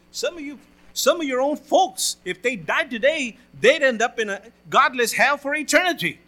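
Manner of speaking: loud, urgent preaching warning; full-force preaching. Acted. Pastor warning the congregation about hell in a loud, urgent preaching voice. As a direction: warn them loudly about hell.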